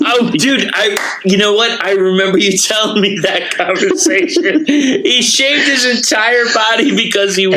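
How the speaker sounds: high, breaking into laughter; laughing. Real, not acted. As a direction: talk through real laughter. Hearing the shaved-body story.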